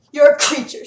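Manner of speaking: loud contemptuous retort; spat. A narrator's reading. Sarah spitting an insult at the tribune. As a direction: spit it at him. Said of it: "Sarah spat at him".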